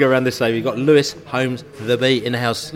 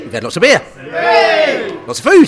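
much higher, louder excited shouting; 12 semitones higher, 9 dB louder than usual. Real, not acted. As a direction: shout it over the cheering pub. Shouting over cheering pub.

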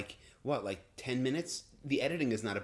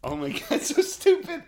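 laughing disbelief; 11 dB louder than usual. Real, not acted. Laughing about ASMR money.

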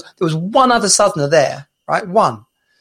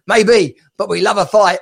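higher, louder Scottish caller; attempted Scottish accent; 4 semitones higher, 3 dB louder than usual. Scottish caller answering the host, cheeky put-on accent. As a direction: own up cheekily in mock Scottish. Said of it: "not gonna attempt to do a Scottish accent".

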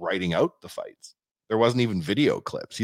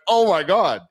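high, loud thrilled exclamation; re-enacted amazement; 10 semitones higher, 7 dB louder, slower than usual. Buyer hearing the owner say okay, acted amazed excitement. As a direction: exclaim as if overjoyed.

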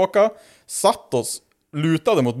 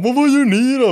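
higher, louder voice for an imitated kid; teen car kid; 11 semitones higher, 5 dB louder than usual. Local kid calls out to drunk strangers, high mock voice. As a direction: pipe up as a curious kid.

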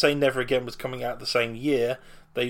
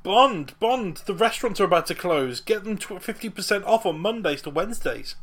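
posh panicking boss; 8 semitones higher, 3 dB louder than usual. Retold or imitated. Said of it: "it's like".

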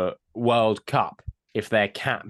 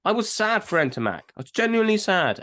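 ordinary delivery

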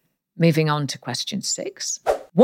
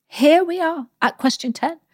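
excited high announcement; 10 semitones higher, 4 dB louder than usual. Acted. Host announces the final question.

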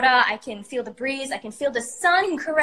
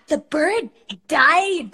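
slow, louder, drawn-out words; a lightly voiced deadpan teacher; mock teacher; 6 dB louder, much slower than usual. Storyteller imitating her drama teacher's reply, slow and louder.

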